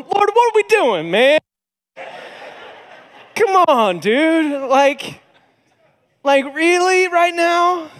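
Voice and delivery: high, loud, drawn-out whine of protest; exasperated pleading. Retold or imitated. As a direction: plead upward in mock exasperation. Exasperated protest to God, acted.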